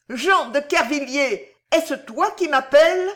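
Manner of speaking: strident cry. A narrator's reading. Madwoman breaks free of the cardinal, strident cry. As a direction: cry out stridently, pitched well up. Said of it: "d'une voix stridente cria".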